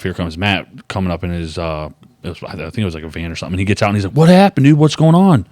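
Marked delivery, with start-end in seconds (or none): 4.1-5.5 acted-out urgent questions, higher and louder; alarmed friend. Friend finds him stuck in a rut, alarmed questioning. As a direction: call out like a friend just pulling up. Voices friend pulling up to help.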